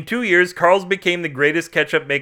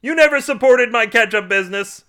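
high-pitched, indignant yell; high, raised-voice complaint; accusing shout; 8 semitones higher, 4 dB louder than usual. A narrator's reading. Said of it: "Carl shouted".

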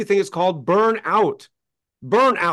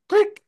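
light switch click. Retold or imitated. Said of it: "I come in and I go".

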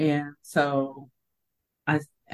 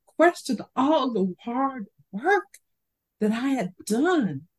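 inner outrage; 7 semitones higher than usual. Retold or imitated. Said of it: "I'm thinking".

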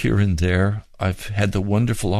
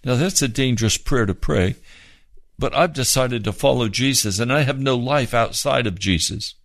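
ordinary delivery

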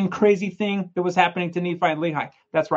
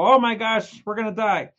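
panicked cry; 5 semitones higher, 6 dB louder than usual. Acted. Panicked prisoners in story.

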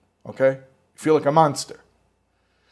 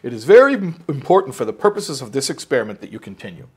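emphatic repeat; 2 semitones higher, 7 dB louder than usual. Retold or imitated. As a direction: hammer the scripted line home. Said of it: "They would just say that line".

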